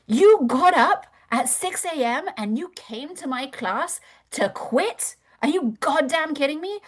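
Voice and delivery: high, pushed yelling coach; retold drill yell. Fitness instructor yelling at a student mid wall sit. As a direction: bark it at her like a drill instructor. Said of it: "she would yell into my face".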